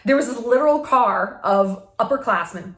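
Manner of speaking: usual speaking voice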